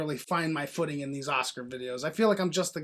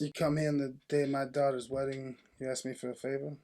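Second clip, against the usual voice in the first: soft, lowered Godfather voice; mob boss impression; 5 semitones lower, 11 dB quieter than usual. Showing co-host his Godfather, hushed low impression. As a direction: play the quiet, menacing don. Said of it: "Is that good?".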